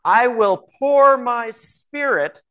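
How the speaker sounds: scripture reading leaning hard on "spirit"; emphatic inflection. Shown as a demonstration. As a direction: lift and slowly stretch "pour my spirit". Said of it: "listening to my inflection, what I emphasize".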